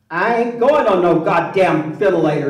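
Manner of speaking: loud defiant refusal as mom; defiant mother. Retold or imitated. Mother in the hospital refuses the ventilator with a loud defiant voice. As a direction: belt it out stubbornly.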